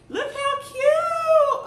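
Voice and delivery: high, slow, loud squeal of delight. Real, not acted. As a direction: squeal it out like it's the cutest thing ever. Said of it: "I gotta show y'all something else".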